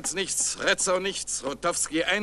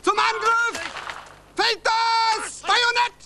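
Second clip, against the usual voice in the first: shouting commands. Acted. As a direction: yell the charge at the troops. Ordering a bayonet charge.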